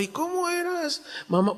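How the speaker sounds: put-on young Isaac gently asking his mother; child asking mother. Isaac asks mom about her past, curious child voice.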